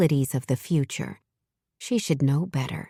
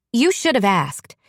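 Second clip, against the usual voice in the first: a snapped retort, a bit higher; sharp snap; 5 semitones higher than usual. A narrator's reading. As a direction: snap the words back at him. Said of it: "she snapped back".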